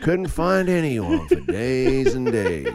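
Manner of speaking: put-on slow, drawn-out old narrator voice; storybook voiceover, stretched out slow; old-timey narrator. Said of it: "an old-timey voice".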